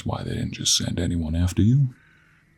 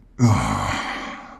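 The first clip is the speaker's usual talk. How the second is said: voiced groaning sigh, falling low; long, low exasperated groan; frustrated sigh; 8 semitones lower than usual. Realizes he is lost.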